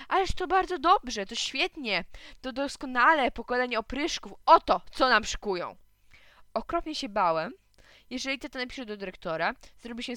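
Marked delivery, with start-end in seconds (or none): 0.1-5.8 high, loud mock enthusiasm; indignant father piling up false praise; sarcastic rant. A narrator's reading. (podniesiona, ironiczna tyrada)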